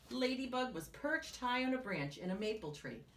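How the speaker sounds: ordinary delivery, nothing marked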